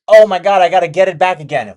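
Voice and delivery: loud, hurried mock panic; panicked outburst. A trader voicing panic after losing the money. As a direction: blurt it out like a trader desperate to win it back.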